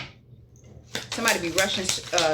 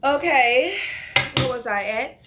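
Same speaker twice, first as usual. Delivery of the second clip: loud; 6 semitones higher, 6 dB louder, slower than usual. Real, not acted. Loud restart after a pause.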